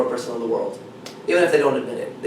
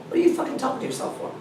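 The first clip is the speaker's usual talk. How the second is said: usual speaking voice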